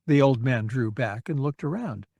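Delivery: plain reading voice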